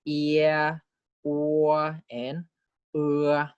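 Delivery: "ear", "or", "er" as long level vowel glides; diphthong demonstration. Shown as a demonstration. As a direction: draw out each diphthong separately. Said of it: "and the three diphthongs are".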